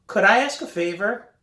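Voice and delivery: high, careful little ask; timid request. A narrator's reading. Timid ask to grouchy dad.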